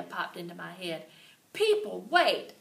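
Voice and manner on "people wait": calling out. A narrator's reading. Calling the class's attention.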